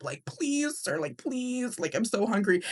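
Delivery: lightly acted high plea; pleading. Hungry boy begs the line guard, pleading.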